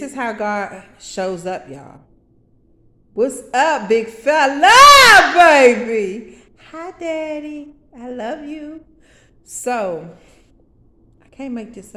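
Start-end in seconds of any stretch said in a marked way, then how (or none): 3.1-6.4 booming slow greeting from God; God's big voice. Retold or imitated. God greets his daughter in a loud, slow, booming voice. 6.7-8.8 put-on soft, high little-girl voice; childlike reply. Daughter answers God in a soft, high, childlike voice.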